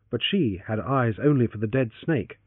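ordinary delivery

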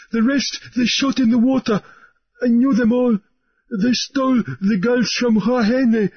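high, urgent outpouring; a terrified man spilling out his story; high, breathless telling of horrors; frantic harelipped sailor. A narrator's reading. Harelip's long frantic tale.

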